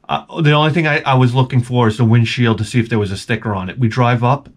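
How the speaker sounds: ordinary talk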